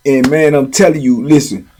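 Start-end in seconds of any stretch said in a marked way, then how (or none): none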